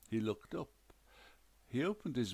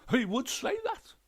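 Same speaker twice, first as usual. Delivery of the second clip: high, loud scorn; scornful ghost wife; 9 dB louder than usual. A narrator's reading. Ghost wife scoffs at the detective, high and loud. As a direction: wave the verdict away, high and loud.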